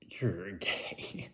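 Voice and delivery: deadpan whispered jab; wife teasing husband in a whisper. Pretend. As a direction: whisper it like a secret insult. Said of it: "And she was like".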